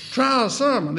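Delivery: put-on high-pitched South Carolina accent. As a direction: mimic the preacher's South Carolina speech. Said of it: "had that South Carolina accent".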